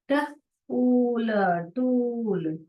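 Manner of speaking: ट, ऊल very slow, then टूल; blending टूल. Shown as a demonstration. Teacher to kids, slow blending of टूल. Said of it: "जोड़ जोड़ के पढ़िए".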